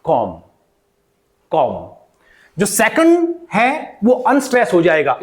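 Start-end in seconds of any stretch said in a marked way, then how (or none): 0.0-1.7 "com" said alone, twice, with round o; a separate, falling "com" each time; stressed first syllable. Shown as a demonstration. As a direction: model "com" as a full KOM. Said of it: "इस पर आपको stress लगाना".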